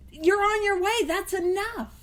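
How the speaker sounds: high-pitched, emphatic assurance. Shown as a demonstration. Assuring newcomers they are on their way, with emphasis. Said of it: "But with emphasis".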